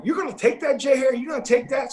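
high, mocking egg-on from the guys; teasing taunt. Teammates goading the catcher in the clubhouse, high mocking taunt. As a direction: needle him like teammates stirring trouble. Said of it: "and guys going".

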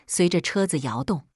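usual speaking voice

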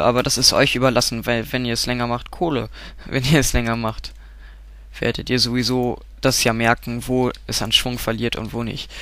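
ordinary talk, nothing marked

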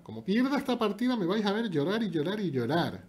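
high, slow pretend whimper; mock whining. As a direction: whine about crying. Amenaza en broma con llorar. (lloriqueo fingido)

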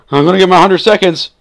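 fast, high, loud outburst; excited shout. Real, not acted. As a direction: burst out loudly, rushing the words. Excited as the goal nears in game.

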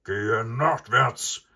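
loud, drawn-out furious roar; angry bull's bellow, very loud. A narrator's reading. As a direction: bellow it slowly as an enraged bull. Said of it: "brüllte der wütende Bulle".

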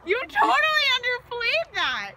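loud, high burning-mouth shouts; shouting from spice heat. Real, not acted. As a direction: yell at the spicy heat. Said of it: "that's really hot".

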